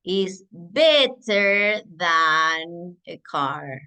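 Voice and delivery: slow, careful English example; teacher dictating slowly; slow model sentence. Shown as a demonstration. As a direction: stretch each word for the class. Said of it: "voy a decir".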